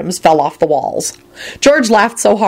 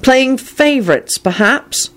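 perplexed; 7 semitones higher, slower than usual. A narrator's reading. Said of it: "in a perplexed voice".

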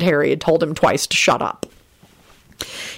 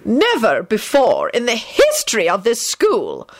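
slow, raised-pitch, ominous pronouncement; deathly; 5 semitones higher, slower than usual. A narrator's reading. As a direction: weigh every word like a verdict. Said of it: "pronounced in a deathly tone".

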